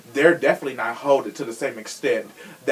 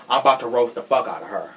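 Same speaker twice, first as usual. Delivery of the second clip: normal talking voice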